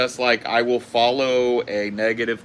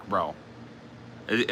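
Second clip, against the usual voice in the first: usual speaking voice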